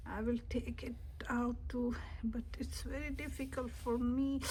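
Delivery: soft tense whisper. Real, not acted. Kid whispering while pulling a block, hushed. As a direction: breathe it out, concentrating.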